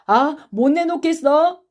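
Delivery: loud, high, slow question; last warning, drawn out and rising; heated pressing challenge; threatening demand. Acted. Accuser threatens silent boy.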